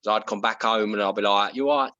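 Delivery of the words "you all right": cockney greeting, slightly exaggerated; thickened East London accent. Guest greeting housemate after filming, East London accent. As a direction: lay on a little East London. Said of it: "you can tell that you've spent a day filming".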